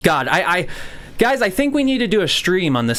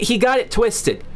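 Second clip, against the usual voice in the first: ordinary talk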